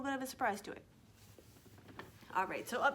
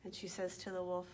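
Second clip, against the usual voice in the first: ordinary delivery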